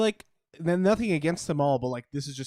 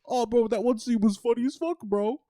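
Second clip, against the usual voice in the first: higher-pitched hyped-up bro chatter, climbing as it goes; mock bro talk, pitched up; excited bros; 5 semitones higher than usual. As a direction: imitate dudes gushing about movies. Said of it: "like, three fucking bros, just like".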